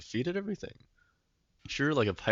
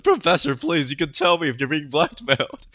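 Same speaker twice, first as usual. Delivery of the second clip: loud, urgent mock plea; shouting; 5 semitones higher, 9 dB louder than usual. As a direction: implore the professor, hammed up and loud. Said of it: "he's literally shouting in his speech bubbles".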